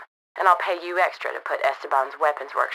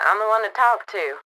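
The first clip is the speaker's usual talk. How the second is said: ordinary delivery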